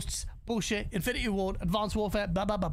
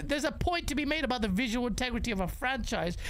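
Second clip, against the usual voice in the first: ordinary talk, nothing marked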